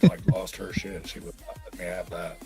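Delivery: normal talking voice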